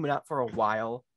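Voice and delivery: normal talking voice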